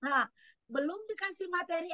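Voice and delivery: normal talking voice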